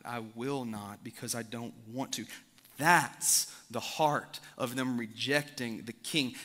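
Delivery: ordinary delivery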